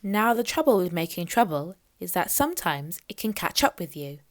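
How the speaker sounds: ordinary delivery, nothing marked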